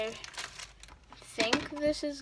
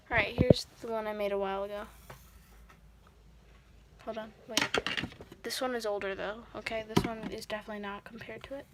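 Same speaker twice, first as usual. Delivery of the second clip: ordinary delivery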